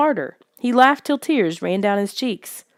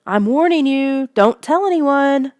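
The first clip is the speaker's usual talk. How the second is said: higher, louder, slow shouted warning; shouting warning; 7 semitones higher, 5 dB louder than usual. A narrator's reading. Lissa shouting after the fleeing boy, slow and loud. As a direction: yell it after him as a threat. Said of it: "her shout rang in my ears".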